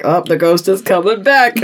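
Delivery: laughing mock alarm. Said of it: "he's like".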